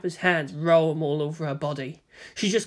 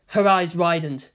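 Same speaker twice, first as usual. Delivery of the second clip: usual speaking voice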